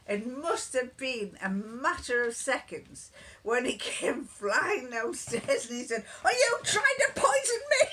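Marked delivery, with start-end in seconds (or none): 6.2-7.9 high loud indignant cry; hammed-up accusation with a laughing cry; mock outraged yell. Husband accusing her, retold.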